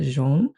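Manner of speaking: "Jean" the French way, like "zhohn"; French pronunciation. Shown as a demonstration. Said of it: "French accent, you're right".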